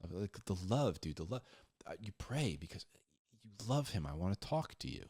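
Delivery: ordinary talk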